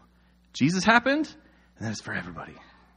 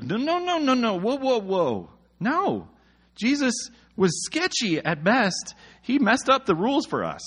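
much higher, squeaky mock outrage; outraged protest; 9 semitones higher than usual. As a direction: sputter in shrill indignation.

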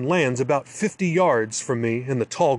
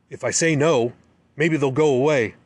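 plain reading voice

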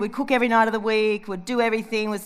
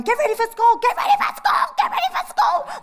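crazy woman yelling; 14 semitones higher than usual. Retold or imitated. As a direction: screech it like a frazzled mum losing it. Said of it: "Like a crazy woman, I'd be yelling".

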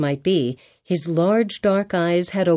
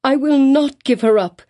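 higher and louder, close to a shriek; near shriek; 7 semitones higher, 5 dB louder than usual. A narrator's reading. Hester repeating her claim, nearly shrieking. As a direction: raise your voice almost to a shriek. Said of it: "raising her voice almost to a shriek".

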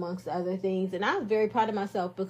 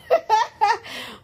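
laughs; 13 semitones higher, 4 dB louder than usual. Real, not acted. Laughs about no promotion.

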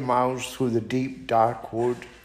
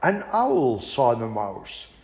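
ordinary delivery, nothing marked

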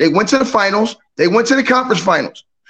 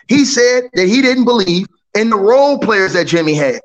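normal talking voice

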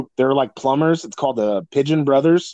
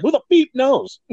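high mock clown voice saying "beep" instead of the swear; 11 semitones higher, 3 dB louder than usual. Clown answers why it beeps, high mocking voice. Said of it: "And he's like".

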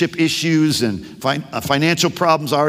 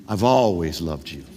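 much lower, softer, unhurried reassurance; reassuring God; 14 semitones lower, 5 dB quieter than usual. Acted. God reassuring the doubting believer, low and tender. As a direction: lay it down low like a hand on a shoulder. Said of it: "he wants to reassure you".

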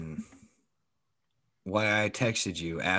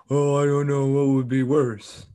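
mocking, higher-pitched imitation of a sarcastic remark; snarky; 4 semitones higher than usual. Co-host quoting his friend's jab from work, snarky mimicry. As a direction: throw their words back in a snarky put-on voice. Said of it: "you had to get snarky".

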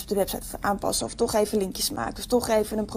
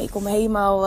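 ordinary talk, nothing marked

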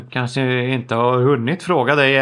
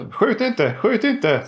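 high-pitched surrendering plea; mock pleading; 8 semitones higher, slower than usual. Player backs out with hands raised to gunman, pleading. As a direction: plead with hands up, play-acted. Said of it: "jag håller upp händerna så här".

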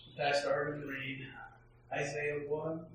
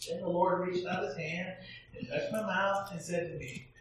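louder, lifted proclamation of the verses; scripture reading; 4 semitones higher, 4 dB louder than usual. Acted. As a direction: read the prophet's call like a sermon. Reads scripture aloud.

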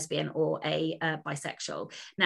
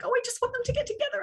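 much higher, swooning over a book; gushing excitement; much faster than usual. Retold or imitated. Author recalling reading a love story, high gushing excitement. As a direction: gush like a smitten fan. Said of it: "I was just like".

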